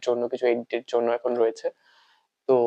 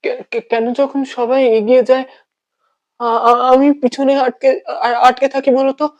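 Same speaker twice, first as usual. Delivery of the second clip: loud, higher, stammering plea; anguished outburst; 11 semitones higher, 7 dB louder than usual. Acted. Left behind while everyone moves on, anguished. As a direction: stumble on first words as the hurt bursts out. Anguished question in acted monologue.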